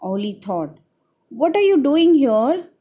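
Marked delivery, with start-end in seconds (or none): 1.4-2.7 surprised. Acted. Owl finds the shivering chick.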